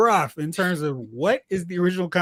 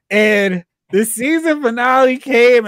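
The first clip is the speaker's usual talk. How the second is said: normal talking voice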